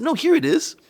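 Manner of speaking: high, loud retold exclamation; insisting. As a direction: blurt it out brightly. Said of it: "I, I, I said".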